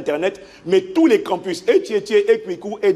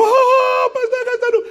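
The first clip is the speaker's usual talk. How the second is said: high, shrill-pitched wailing woman; crying hotel maid; 4 dB louder, slower than usual. Retold or imitated. Pastor mimicking the maid bursting into his room, high wail. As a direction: squeal it like a frantic woman at the door.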